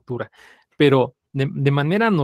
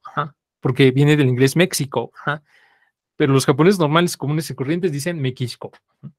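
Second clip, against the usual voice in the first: usual speaking voice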